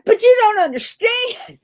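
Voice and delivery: high, loud, frantic pleading; panicked protest. Retold or imitated. Friend mimicking the frantic student, panicked protest. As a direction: play the overwhelmed student. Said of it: "she was like".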